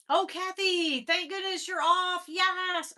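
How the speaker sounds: high, loud cheer of relief; delighted greeting. Real, not acted. Host greeting Kathy as she joins, delighted greeting. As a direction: call out to a friend, thrilled.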